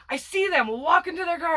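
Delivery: ordinary talk, nothing marked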